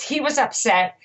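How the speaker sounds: usual speaking voice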